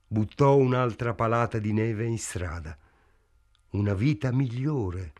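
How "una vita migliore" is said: ordinary delivery, nothing marked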